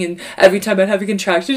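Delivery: ordinary talk, nothing marked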